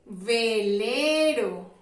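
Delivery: Velero drawn out slowly; slow model word. Shown as a demonstration. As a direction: stretch Velero so children hear the V. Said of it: "Tiene la sílaba V".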